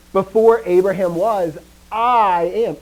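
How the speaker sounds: forceful stress on "I"; emphatic claim. Retold or imitated. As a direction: declare it boldly, leaning on "I". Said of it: "he's actually emphasizing his claim".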